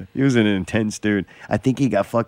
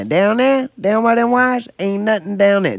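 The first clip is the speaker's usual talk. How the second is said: weird put-on voice; 12 semitones higher than usual. Retelling a party guy's weird voice, high goofy imitation. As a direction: imitate the drunk buddy's strange high voice. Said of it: "He's obviously doing a voice".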